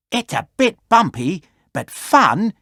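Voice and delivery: playful little toy voice, bumpy but fun; small cuddly toy enjoying the ride; Ellie's thoughts. A narrator's reading. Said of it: "he thought".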